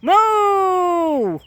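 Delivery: high, loud bellowed cow moo; full-voiced cow impression. Shown as a demonstration. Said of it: "Let the viewers hear you".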